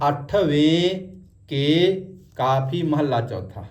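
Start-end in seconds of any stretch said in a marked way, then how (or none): 0.1-1.7 ਅੱਠਵੇਂ ਕੇ slowly, with long e endings; final -ven ending. Shown as a demonstration. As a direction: hold the e in ਅੱਠਵੇਂ, then ਕੇ. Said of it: "ਅੱਠਵਾਂ ਨਹੀਂ ਬੋਲਣਾ".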